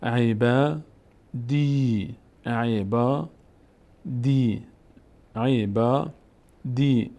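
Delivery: عیبا then دی, slowly, vowels held; long-vowel reading. Shown as a demonstration. Teacher drills the example word, long vowels. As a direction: draw out e-baa, dii in عیبا دی. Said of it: "اینجا صدای کشیدهٔ آ".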